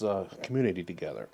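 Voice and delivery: ordinary talk, nothing marked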